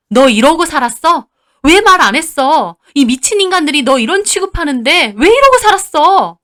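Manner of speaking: high, loud reproach; anguished angry wailing. Acted. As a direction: sister crying out in outrage. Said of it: "언니가 울부짖으며 저한테 화를 내더라고요". (울부짖듯 화내는 언니)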